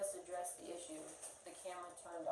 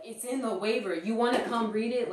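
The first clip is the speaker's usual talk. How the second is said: retold yelling; 4 semitones higher, 16 dB louder than usual. Witness acting out owner yelling at customer, loud mock yell. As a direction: imitate a loud, higher-pitched yell. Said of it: "He's, like, yelling".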